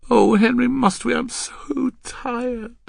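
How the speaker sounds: high, slowing, tired whine; weary pleading girl. Acted. Girl too tired to practise for Henry, whining. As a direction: let the plea trail into airy exhaustion.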